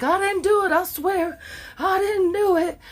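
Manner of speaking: high, pleading fake sob; hammed-up terrified denial; mock panicked pleading. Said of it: "She's rocking so bad in the chair".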